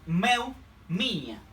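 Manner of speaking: "Meu" with an eu glide, "minha" with the nh said like ñ, slowly; Portuguese meu, minha. Shown as a demonstration. Teacher modelling possessives for Spanish speakers, very slow. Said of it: "Es como la ñ con la i".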